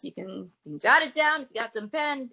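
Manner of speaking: ordinary delivery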